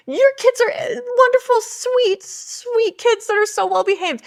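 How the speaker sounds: put-on high, loud gushing over sweet kids; gushing praise. Wife apologising to Katie, gushing high and loud. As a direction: overdo the praise to make amends.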